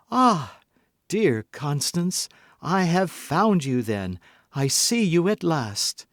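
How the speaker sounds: overflowing fake affection; tender joy. Milady feigns joyful reunion.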